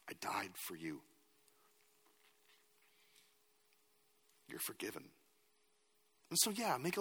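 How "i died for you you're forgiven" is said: soft whisper of forgiveness; tender whisper. Pretend. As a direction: whisper it like a comforting father. God's tender words voiced.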